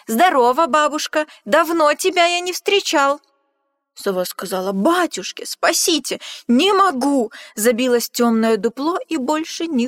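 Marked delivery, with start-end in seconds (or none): 0.1-3.2 loud, high friendly greeting; cheery shout. Acted. Mouse calling out loudly to the owl. 4.7-7.3 high, loud cries for help; panicked cry. Acted. Owl crying for help in fright at the Gruffalo.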